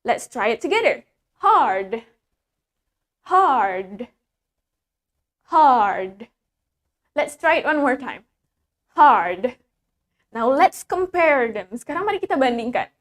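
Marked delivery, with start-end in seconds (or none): 1.4-6.3 HARD with a clearly sounded R, low and drawn out; slow, emphatic HARD with an audible R; clear American R. Shown as a demonstration. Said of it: "American has more clear R pronunciation". 8.9-9.6 HARD with a clearly sounded R, low and drawn out; slow, emphatic HARD with an audible R; clear American R. Shown as a demonstration. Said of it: "American has more clear R pronunciation".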